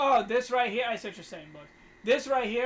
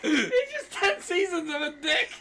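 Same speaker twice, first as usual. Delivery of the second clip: high and slow, wheezing with laughter; laughing; 9 semitones higher than usual. Real, not acted. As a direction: giggle through the whole line. Laughing at own joke.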